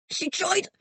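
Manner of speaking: high, strained wheeze while choked; wheezing. Acted. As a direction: squeeze the words out, half choked. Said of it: "he wheezed".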